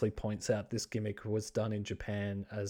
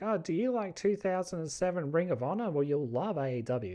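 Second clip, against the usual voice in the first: a sneering, higher mock voice for the critics; 5 semitones higher than usual. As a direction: parody the smug fans dismissively. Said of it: "I've seen some people be like".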